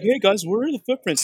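high, loud clueless question; breezy oblivious call. Retold or imitated. Imitates Robert stepping on track.

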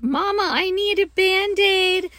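put-on high, small child spider voice; little spider. Teacher voicing the spider calling to mama, high and small. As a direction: squeak it up like a hurt little kid. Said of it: "he's like".

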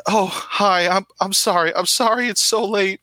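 high, shaky, frightened apology; shaking voice. Acted. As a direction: stammer out a scared apology. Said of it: "why are you shaking".

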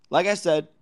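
usual speaking voice

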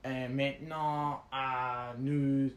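mock pilot announcing in broken French with a Canadian English accent, slow and halting; bad French accent. Friend mimicking the pilot's awful French announcement, a laughing mock French accent. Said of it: "And then he switches to French".

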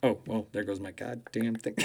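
usual speaking voice

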